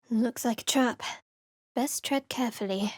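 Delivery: unvoiced whisper, cautious; wary whisper. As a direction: hush it so no one hears. Wary whisper spotting a trap.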